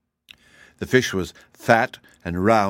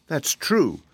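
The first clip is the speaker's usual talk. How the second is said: musing agreement, pitched up; thoughtful; 6 semitones higher than usual. A narrator's reading. Boy agreeing with Dorothy about the fairy country, thoughtful. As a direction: agree as if thinking it over. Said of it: "said Zeb thoughtfully".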